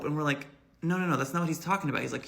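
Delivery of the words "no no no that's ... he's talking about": fast rush of no, no, no; quick correction. Retold or imitated. Riders jumping in to correct the bus driver. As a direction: hurry to correct him.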